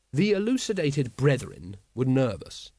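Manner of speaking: ordinary delivery, nothing marked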